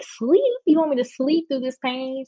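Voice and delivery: high-pitched disbelief, retold; incredulous retort. Laboring woman answering advice to sleep, incredulous. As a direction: throw the suggestion back as an absurd question.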